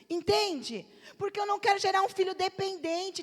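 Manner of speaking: usual speaking voice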